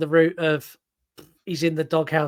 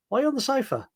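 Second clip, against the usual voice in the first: puzzled wife; 8 semitones higher, 3 dB quieter, much faster than usual. Retold or imitated. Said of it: "Lisa will come down".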